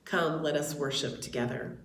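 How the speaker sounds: ordinary delivery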